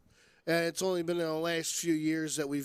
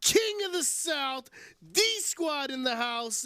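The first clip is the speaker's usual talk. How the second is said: hype intro; 7 semitones higher than usual. Acted. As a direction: boom out the names like a ring announcer.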